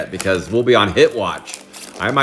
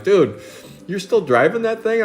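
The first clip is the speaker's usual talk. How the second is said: higher, teasing disbelief; mocking buddy; 10 semitones higher than usual. Buddies mocking his old car, teasing high voice. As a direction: rib him like an old friend. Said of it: "They're like".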